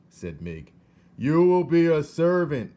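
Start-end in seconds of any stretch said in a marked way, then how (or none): 1.2-2.7 very loud high shout. Acted. Soldier shouting to hard-of-hearing Mig. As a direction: bellow it so she hears. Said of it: "shouted the soldier".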